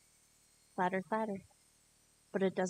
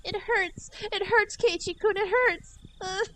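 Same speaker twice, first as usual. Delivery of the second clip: much higher, louder cries of pain; pained yelp; 13 semitones higher, 7 dB louder, slower than usual. Acted. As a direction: yelp as fingers are crushed. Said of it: "a yelp that I was trying to keep back".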